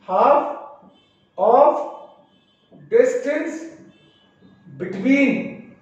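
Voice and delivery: each word spaced out for note-taking; slow dictation. Shown as a demonstration. Teacher to students, dictating the definition slowly. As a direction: read it out word by word so students can write.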